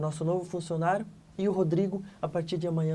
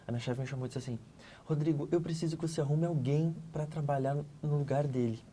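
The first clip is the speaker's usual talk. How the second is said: usual speaking voice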